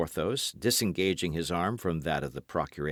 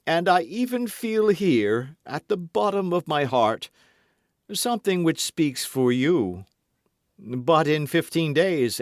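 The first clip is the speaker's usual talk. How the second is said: lightly voiced swaggering musketeer, raised pitch; Porthos voiced; 8 semitones higher, 4 dB louder than usual. A narrator's reading. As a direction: play Porthos pleading with mock hurt. Dialogue continues.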